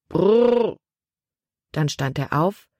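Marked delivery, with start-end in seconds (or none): 0.1-0.8 high trilled driver's halt call; called-out "brrr". A narrator's reading. Wagon driver halting his horses, called-out trill. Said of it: "rief er".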